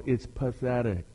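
ordinary talk, nothing marked

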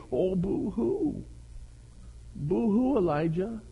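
high, soft, drawn-out mock crying; mock pity. Preacher mocking self-absorbed Elijah, fake sympathy. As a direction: tease with a babyish little boo-hoo.

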